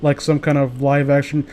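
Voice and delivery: ordinary talk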